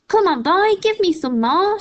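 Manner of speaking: high loud screeching crone demand; yell. A narrator's reading. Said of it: "she yelled".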